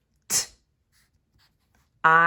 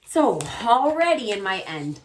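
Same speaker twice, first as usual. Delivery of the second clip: normal talking voice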